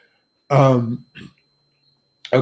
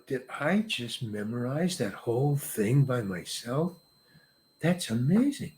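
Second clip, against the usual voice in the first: hushed, much softer private thought; amazed to himself; 3 semitones lower, 11 dB quieter than usual. Retold or imitated. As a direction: marvel quietly at your own feat.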